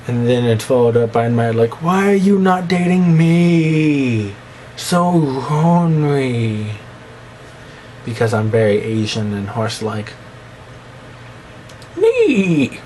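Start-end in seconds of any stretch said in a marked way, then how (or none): 1.8-6.7 high, very slow whine, drawn-out; whiny mock plea. Vlogger pleading with a crush, high drawn-out whine. Acted whiny quote of himself. 12.0-12.8 sudden very high squeak; squeaky yelp. Acted. Vlogger answering his own joke, very high squeak.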